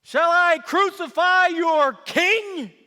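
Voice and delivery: slow, high, loud public challenge; calling to crowd. Acted. Governor calls out to the mob, slow and loud. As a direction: project it over the mob, slowly.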